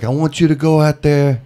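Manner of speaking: lightly voiced, bossy headliner; half-hearted Dice impression; terrible Dice impression. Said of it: "I'm doing a terrible Dice".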